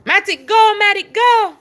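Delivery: loud, high repeated commands; shouting at the dog to go pee; calling the dog. Real, not acted. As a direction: keep urging the dog out loudly.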